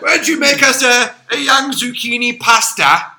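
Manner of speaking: posh mock English request; English accent. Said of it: "the English accent".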